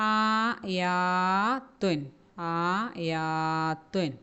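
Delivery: slow, drawn-out ആയാത്തുൻ with the long aa held; Quranic word recitation. Shown as a demonstration. Teacher modelling a Quranic word for children to repeat. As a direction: stretch the madd in ആയാത്തുൻ, then repeat it. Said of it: "okay, അടുത്ത".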